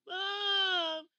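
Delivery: put-on high, loud, drawn-out kid whine; whining child. Woman retelling her childhood complaint to Mom, imitating a high whine. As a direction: wail it slowly like a frustrated child. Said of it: "But I said".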